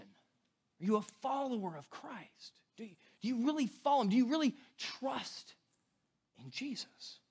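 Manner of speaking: a quiet heartfelt probe into real faith; earnest searching question. Shown as a demonstration. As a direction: ask it gently, like it really matters. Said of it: "but that question".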